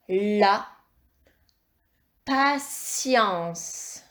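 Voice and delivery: "La patience" drawn out, pause after "La"; slow dictation. Shown as a demonstration. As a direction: stretch "patience" into pa and tience for dictation. Teacher repeats word slowly.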